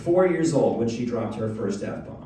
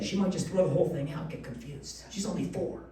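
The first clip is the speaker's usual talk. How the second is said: usual speaking voice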